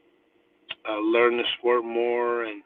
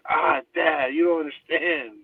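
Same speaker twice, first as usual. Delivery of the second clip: higher-pitched mock teenage protest; pleading protest; 5 semitones higher, slower than usual. Son left at practice by his dad, protesting. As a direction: imitate a kid complaining to a parent.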